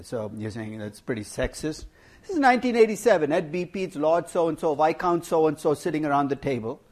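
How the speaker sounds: usual speaking voice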